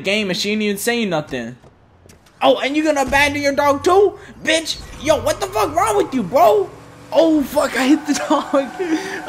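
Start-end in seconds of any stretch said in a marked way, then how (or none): 2.4-6.7 high-pitched indignant rant; outraged. Real, not acted. Outraged at woman abandoning her dog. 7.1-9.3 high-pitched oops, breaking into laughter; shocked laughter. Real, not acted. Accidentally hits the game dog.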